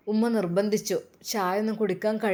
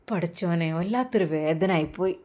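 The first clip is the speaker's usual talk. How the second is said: ordinary delivery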